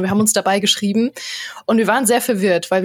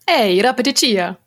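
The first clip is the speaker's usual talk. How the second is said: Sims-style fake Italian greeting. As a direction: greet in made-up game Italian. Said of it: "das Fake-Italienisch von Die Sims zwei".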